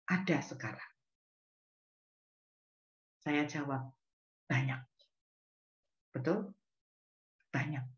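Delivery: soft, low solemn answer; solemn quiet. Real, not acted. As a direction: drop low and quiet for weight. (pelan dan rendah dengan khidmat)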